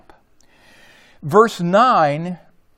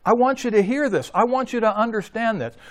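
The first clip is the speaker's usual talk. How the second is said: higher, urgent, pressing the listeners to hear; emphatic voicing of Jesus; 7 semitones higher than usual. Preacher voicing Jesus's call to listen, higher and urgent. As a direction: lift the voice and plead for attention. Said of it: "that's what just Jesus is saying here".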